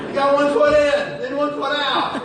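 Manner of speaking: teasing hokey pokey. Shown as a demonstration. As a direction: call it out loud like a playful scolding. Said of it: "You're doing the hokey pokey".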